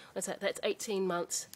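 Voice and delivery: ordinary talk, nothing marked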